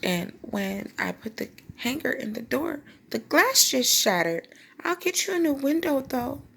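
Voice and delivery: high-pitched acted excuse; hammed-up innocent explaining; lying to mom. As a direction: plead innocence, climbing high as the story goes on. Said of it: "I was lying my butt off".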